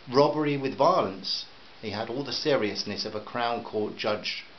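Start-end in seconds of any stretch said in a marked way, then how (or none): none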